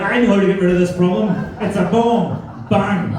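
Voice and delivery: mock eager gunner. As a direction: act out a dim soldier's bright idea. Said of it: "You'll be like".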